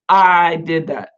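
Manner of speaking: "I" drawn out slowly and stressed; emphatic I. Acted. Preacher voicing the Lord claiming the Exodus miracles, emphatic. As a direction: stretch "I", then finish firmly.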